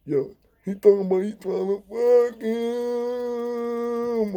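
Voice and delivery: very slow, high, slurred mock deaf speech; mimicking Habao. Deaf inmate Habao explaining overheard plot, slow high mock deaf voice. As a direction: drag each word out high like a deaf man. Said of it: "What they talking about".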